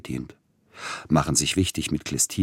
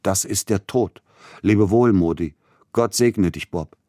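sudden raised farewell, voice jumping higher; cry of death; 4 semitones higher, 5 dB louder than usual. A narrator's reading. Dying man cries farewell to his friends, raised voice. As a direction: cry out goodbye as the heart attack grips. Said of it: "ihn schreien machen".

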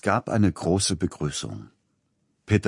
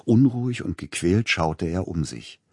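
usual speaking voice

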